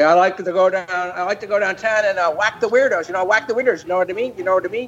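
high, nasal-free mock cabbie voice; Jack Burns cab driver. Comedian retelling a cab driver act, high mock cabbie voice. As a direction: raise the pitch as a rambling cab driver. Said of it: "he would say".

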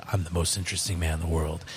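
low, soft put-on character voice; Most Interesting Man. Host quotes the beer commercial character, low suave impression. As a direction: drop into a deep, hushed ad-character voice. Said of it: "in his character of".

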